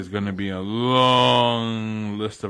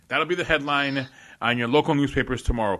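normal talking voice

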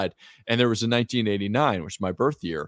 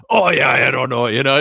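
louder, hammed-up Glaswegian impression; thick Glasgow accent; 3 semitones higher, 6 dB louder than usual. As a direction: imitate a thick Glasgow Scot. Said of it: "like, a thick accent".

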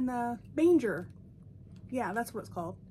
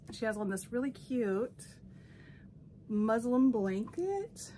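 ordinary delivery

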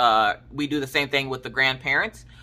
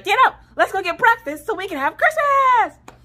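much higher and louder, pushing; rally the kids up; loud cheerful urging; 6 dB louder than usual. Retold or imitated. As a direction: mom hurrying kid to breakfast. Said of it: "I'm like".

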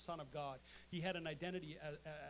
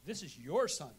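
mock accusing; 10 dB louder than usual. Quarrel with spouse, retold.

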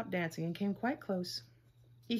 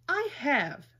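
louder protesting cry; cried out; 5 semitones higher, 6 dB louder than usual. Acted. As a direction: fling it back in protest. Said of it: "cried the little rabbit".

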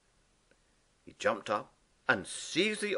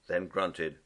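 usual speaking voice

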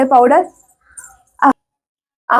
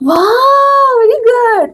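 delighted praise; 9 semitones higher, much slower than usual. Real, not acted. Mother praising her toddler for finding things, delighted. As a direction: lift the praise up high.

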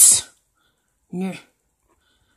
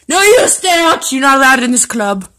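raised-voice banishing command; bossy shout; 3 semitones higher, 5 dB louder than usual. Real, not acted. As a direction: shout the dog out of the club. Ordering the dog out.

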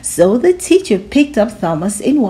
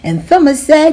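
usual speaking voice

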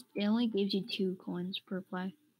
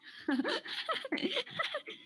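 high laugh. Real, not acted. Laughing at meme.

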